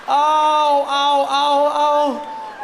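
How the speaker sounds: high, loud ow cries; pained cries. Acted. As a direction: cry out in pain, hammed up. Acting out pain.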